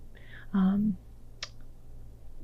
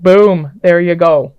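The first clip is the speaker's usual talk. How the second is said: loud, punchy mic-drop call; mic-drop boom; 3 semitones lower, 10 dB louder, slower than usual. Pretend. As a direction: land it loudly like dropping a mic. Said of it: "drop it and just be like".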